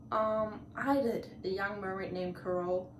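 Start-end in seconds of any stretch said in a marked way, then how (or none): none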